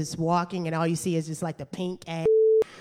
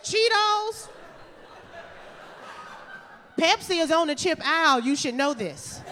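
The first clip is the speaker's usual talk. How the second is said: know-it-all; 8 semitones higher than usual. Retold or imitated. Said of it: "I said".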